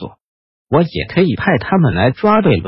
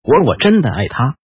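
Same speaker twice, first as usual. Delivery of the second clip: plain reading voice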